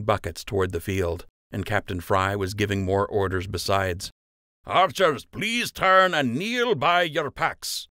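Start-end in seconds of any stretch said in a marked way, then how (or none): 4.7-7.9 higher, slow, firm instruction; Master Fletcher commanding. A narrator's reading. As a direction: order the archers to kneel. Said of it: "Master Fletcher said".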